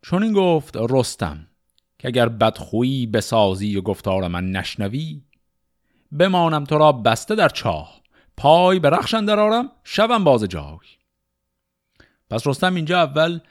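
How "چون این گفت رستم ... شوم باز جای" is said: measured, rhythmic reading of Persian epic poetry; شعرخوانی حماسی; epic verse recitation. Acted. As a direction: recite it like a storyteller of old epics.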